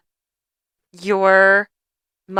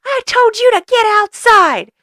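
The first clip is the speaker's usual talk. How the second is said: loud, high, furious scolding impression; hammed-up grandmother tirade; yelling at the dog; 13 semitones higher, 7 dB louder than usual. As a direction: screech it at a misbehaving dog. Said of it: "start yelling at the dog".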